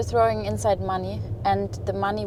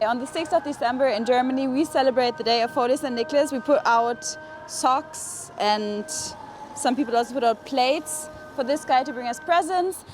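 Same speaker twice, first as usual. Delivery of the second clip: ordinary delivery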